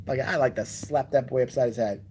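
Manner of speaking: ordinary talk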